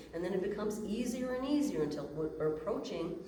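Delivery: ordinary talk, nothing marked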